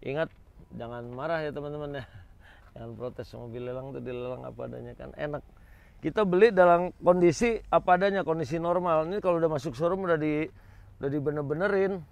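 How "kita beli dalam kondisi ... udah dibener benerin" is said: loud, high, insistent explaining; emphatic loud explaining. Real, not acted. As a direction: press the point hard to viewers. Insisting to viewers about auctions.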